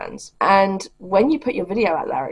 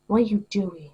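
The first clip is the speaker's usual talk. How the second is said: talking to a dog; 5 semitones higher than usual. Real, not acted.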